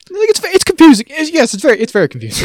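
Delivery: high, put-on flustered voice; mock confusion. Co-host acting out characters who don't understand, mock confusion. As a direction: start very high and loud, settle as you go.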